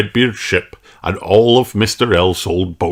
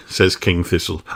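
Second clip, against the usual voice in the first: ordinary delivery, nothing marked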